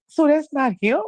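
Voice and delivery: high-pitched mimicked naive question; imitating the niece's guileless chatter; innocent niece, imitated. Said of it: "'Cause the way she said".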